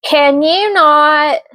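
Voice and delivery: high, loud, drawn-out scolding yell. Real, not acted. Reader telling off her barking dogs, exasperated yell. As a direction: yell it at misbehaving dogs, stretching the words. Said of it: "Sorry for the yelling".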